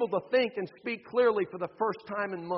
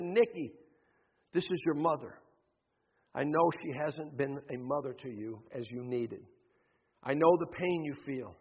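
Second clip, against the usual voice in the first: a hint of Jesus's voice, soft and tender; voicing Jesus gently; 5 semitones lower, 4 dB quieter than usual. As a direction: speak as a gentle Jesus, lower and softer. Jesus speaks to the narrator.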